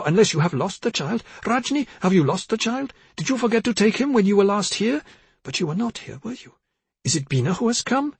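high, questioning, wandering voice; confused mother. A narrator's reading. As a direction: lose the thread, lifting the pitch. Confused mother mistakes her daughter.

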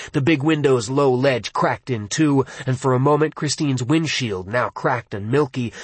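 ordinary delivery

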